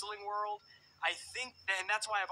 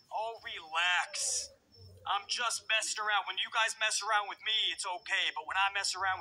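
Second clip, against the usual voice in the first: Braun Strowman voice; 3 semitones higher, 5 dB louder than usual. Retold or imitated. Host mimicking Braun Strowman brushing off angry fans, loud and dismissive. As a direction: puff up and wave off the critics like a giant. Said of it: "in the Braun Strowman voice".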